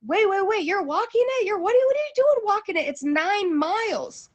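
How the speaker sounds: high, rapid-fire disbelief slowing at the end; incredulous stranger. Retold or imitated. Stranger hearing she'll walk nine miles, imitated high-pitched disbelief. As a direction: imitate a guy stunned by the plan.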